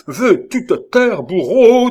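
high, loud, drawn-out rebuke; tearful outburst. A narrator's reading. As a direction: protest loudly, upset and near tears. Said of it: "les larmes aux yeux". (reproche crié, les larmes aux yeux)

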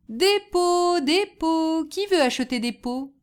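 high, drawn-out hawking call; street vendor's cry. A narrator's reading. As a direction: hawk your wares through the streets. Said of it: "cria-t-il dans toutes les rues".

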